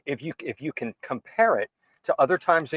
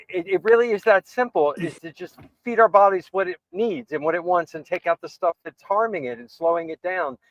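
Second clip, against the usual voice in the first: ordinary talk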